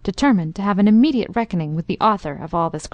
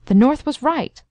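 higher-pitched, stubborn insistence; 6 semitones higher than usual. A narrator's reading. Young Dick standing up to the Major, stubborn. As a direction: dig in stubbornly, pitch lifted.

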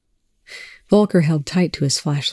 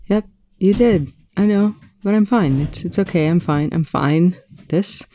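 higher, rattled reassurances; strained, pitched-up rambling; rattled babbling; 3 semitones higher than usual. A narrator's reading. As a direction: babble that all is well, unconvincingly. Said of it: "interrupting his meaningless monologue".

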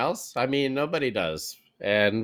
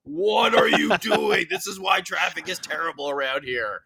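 mock road rage; 3 semitones higher than usual. Mock impatient driver in traffic.

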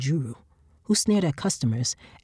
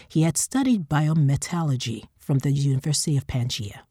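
plain reading voice

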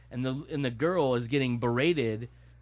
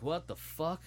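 stunned disbelief; 5 semitones higher, 5 dB quieter than usual. Retold or imitated. Family's stunned reaction, retold.

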